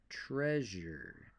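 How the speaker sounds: "Treasure" with a clear zh sound. Shown as a demonstration. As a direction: lean on the zh in "Treasure". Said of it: "sounds we use in speech".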